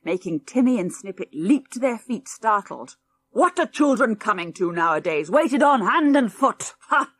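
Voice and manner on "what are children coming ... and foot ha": put-on louder, higher indignant old man; grumbling. Cranky grandad scolding the children, indignant. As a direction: scoff at spoiled children.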